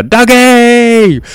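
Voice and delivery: very high, loud, drawn-out holler; yelled name. Retold or imitated. Customers calling to the pizza delivery driver, high drawn-out yell. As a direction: holler it long, stretching the last syllable. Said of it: "we'd be yelling out at".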